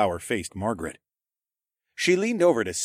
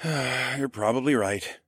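sighing; 3 semitones higher, slower than usual. A narrator's reading. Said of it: "I sighed".

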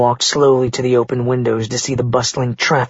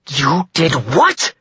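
mild screech; 2 semitones higher, much slower than usual. A narrator's reading. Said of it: "Karina finally screeched".

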